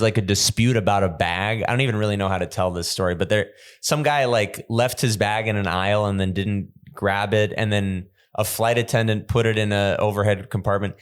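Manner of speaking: ordinary talk, nothing marked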